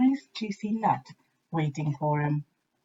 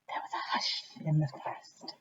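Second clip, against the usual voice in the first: whisper, much softer; whispered hush; 10 dB quieter than usual. A narrator's reading. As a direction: breathe it like a hushed forest. Story hush moment.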